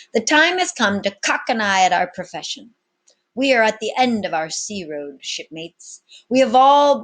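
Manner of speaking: loud, high swaggering captain; commanding captain. Acted. Captain addressing his pirates, loud and bold. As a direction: address the crew like an old sea dog.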